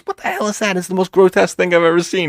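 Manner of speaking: high, hammed-up horrified movie woman; mock a woman shrieking at a guy in the bushes; shrill horrified woman. Woman spotting someone in the bushes, mock horrified.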